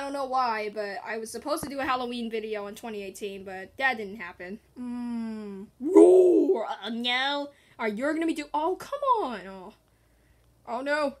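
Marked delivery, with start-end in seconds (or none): 5.8-6.7 loud playful tiger roar. Pretend. Player voicing Tiger in a game, loud mock roar.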